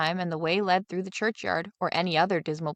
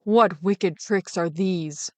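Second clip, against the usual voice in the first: slow scolding father; a mild stern scold; father scolding his son, slow; much slower than usual. Acted. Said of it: "scolded the boy".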